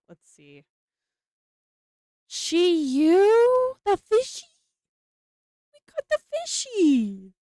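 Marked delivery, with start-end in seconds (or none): 2.3-7.3 high, rising, louder delight; building thrill at a great pull; joyful disbelief; excited. Real, not acted. Pulls a favourite card.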